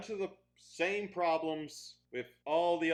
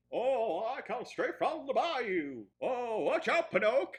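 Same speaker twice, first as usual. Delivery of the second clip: put-on Southern accent; 4 semitones higher, 3 dB louder than usual. Reviewer mimicking Jiminy Cricket, hammed-up Southern accent. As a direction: overdo a Southern cricket from the bayou. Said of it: "Southern, I think?".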